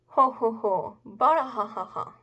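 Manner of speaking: loud hammed-up ho-ho-ho belly laugh; big put-on character laugh; acted hearty laugh. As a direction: read the laughter out big and loud, then let it drop. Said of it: "such a good laugh".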